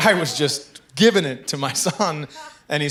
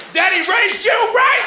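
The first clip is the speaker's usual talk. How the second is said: proud shout; 9 dB louder, slower than usual. Retold or imitated. Proud dad yelling at son.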